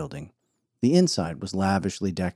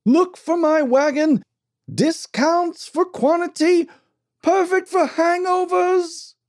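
much higher, louder, slow huckster bellow; 5 dB louder, slower than usual. Acted. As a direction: bellow the sales pitch as he's dragged out. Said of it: "the good doctor bellowed".

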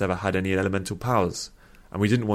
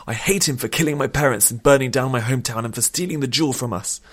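raised, loud, bitter tirade; hateful outburst; 5 semitones higher, 4 dB louder than usual. A narrator's reading. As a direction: let the hatred burst out uncontrolled. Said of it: "unable to contain his outburst".

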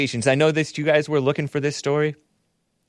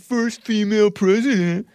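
much higher, hammed-up echo; mock fanfare; 8 semitones higher than usual. Host echoing the news of the new president, mock fanfare. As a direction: repeat it with mock excitement.

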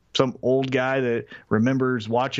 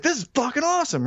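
pitched much higher, gushing; thrilled; 12 semitones higher than usual. Retold or imitated. Fan gushing to the radio host, thrilled. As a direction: squeal it like a giddy fan. Retelling his excitement to a radio guy.

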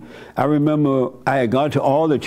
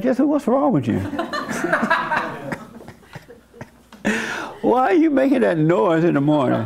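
high, laughing neighbor asking what's wrong; puzzled neighbor; 8 semitones higher than usual. Retold or imitated. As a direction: voice the amused manager across the hall, pitched up. Neighbor asks about morning noise.

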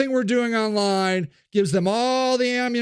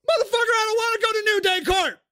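furious shout; 11 semitones higher, faster than usual. Acted. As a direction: scream your refusal at them. Sage shouts, panicked.

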